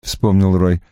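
usual speaking voice

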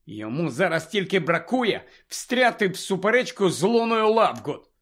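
plain reading voice

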